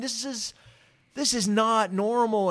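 high, slow, emphatic disbelief; loud, astonished retelling; freaking out. Retold or imitated.

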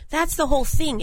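usual speaking voice

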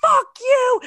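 very high, loud triumphant shriek; screaming at them. Retold or imitated. Patriots fan screaming at taunting bar rivals, shrieking loud. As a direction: hurl it at the rival fans at full volume. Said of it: "I'm, like, screaming in their face".

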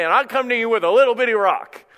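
high, loud mock quote; mocking misquote. Preacher parodies what David didn't say, mockingly. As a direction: exaggerate it as an absurd boast.

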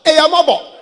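loud, high holler from the car; playful full-voiced holler; re-enacted shout. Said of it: "I'll just shout".

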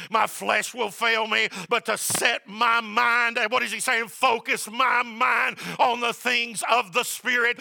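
loud, slow, climbing preacher's cry; preaching at full force. Acted. Pastor urging church to focus on the Spirit, full-force preaching. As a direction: drive it home to the congregation.

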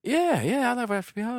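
high, eager voice; mimicking eager fan. Retelling a fan gushing about a reviewer, high eager voice. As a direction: gush like an excited fan. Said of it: "the guy's like".

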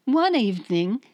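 British attempt turned Australian accent; Liam Hemsworth. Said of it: "get out of my head, Liam Hemsworth".

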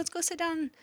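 soft, high mom voice; retold motherly coaxing, light and high; gentle coaxing. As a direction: coax a scared child along. Mom retells soothing scared daughter.

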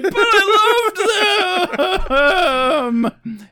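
high, slow, laughing fake sob; drawn-out mock cry, very high; mock wail. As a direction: wail it in hammed-up grief. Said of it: "I'm like".

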